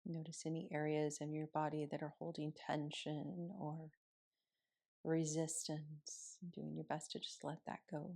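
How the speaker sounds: soft, unhurried meditation voice with long pauses; guided meditation. Acted. Yoga teacher guiding students through morning seated meditation, softly.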